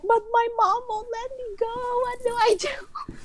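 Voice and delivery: very high, mock-crying whine; whiny friend. Best friend fretting her mom won't allow it, high mock whimper. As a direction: whimper like a panicking teen. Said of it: "she was like".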